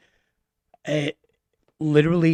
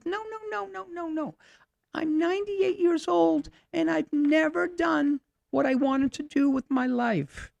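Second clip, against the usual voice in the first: put-on frail old woman. Old Lena voiced, regretful.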